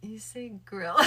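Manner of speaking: ordinary delivery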